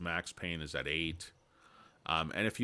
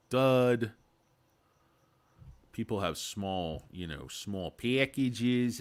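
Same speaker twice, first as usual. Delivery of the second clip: normal talking voice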